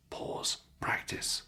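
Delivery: whispered aside to learners; hushed reminder under the lesson; whispered cue. Shown as a demonstration. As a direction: whisper the instruction softly.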